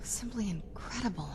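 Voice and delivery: hushed, breathy wonder on simply; soft whisper of amazement; awed whisper. Acted. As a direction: whisper it as if stunned. Same line, repeated take.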